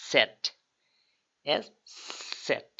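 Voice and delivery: সেট said with a ts start; সেট as "tset", t before s; German letter Z. Shown as a demonstration. Teacher to learners, the German সেট. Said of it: "লাস্টে টেটা জেড লাগাই দেবো".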